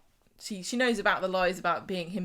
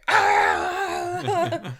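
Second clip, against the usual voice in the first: high, loud operatic wail; sudden piercing opera-singer note; mock opera wail; 11 semitones higher, 8 dB louder than usual. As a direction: belt out a mock soprano cry. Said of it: "got an opera singer in".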